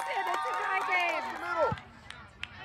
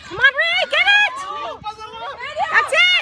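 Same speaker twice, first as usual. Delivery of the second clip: cheering shout; 5 semitones higher, 3 dB louder, faster than usual. Real, not acted. Cheering player on field.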